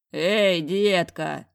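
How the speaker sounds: slow, loud booming man's call. Acted. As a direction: boom it across the hall. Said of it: "Трубный голос".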